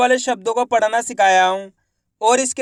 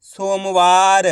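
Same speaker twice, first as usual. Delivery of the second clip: सोमवार said very slowly, syllables joined; slow teaching blend of सोमवार; slow blended reading; 2 semitones lower, 4 dB louder, much slower than usual. Shown as a demonstration. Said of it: "तो मिलके बन जाएगा".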